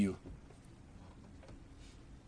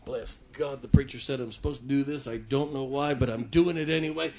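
muttered, much softer, grumbling and quick; grudging mutter; 2 semitones lower, 7 dB quieter than usual. Pretend. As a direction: grumble it under your breath like a resentful giver. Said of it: "Or you can say".

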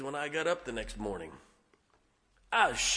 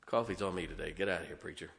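put-on generous young man; 9 semitones lower, 9 dB quieter, faster than usual. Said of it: "He said".

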